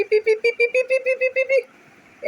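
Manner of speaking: very high, bouncy pee pee pee; high squeaky pee sounds. Acted. Mother coaxing baby with toy, very high squeaky chant.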